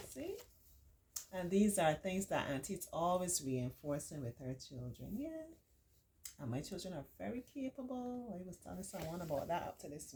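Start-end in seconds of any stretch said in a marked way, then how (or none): none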